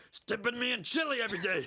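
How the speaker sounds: high comic shouted wail; complaining body part. Pretend. Absurd character complains about chili, high mock shout. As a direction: escalate the silly grievance in a put-on voice. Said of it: "Hello, I'm Alex Jones' cock".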